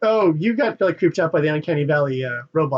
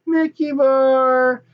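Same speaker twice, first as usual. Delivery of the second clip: mock gushing; 5 semitones higher, slower than usual. Mocking gushing merch buyers.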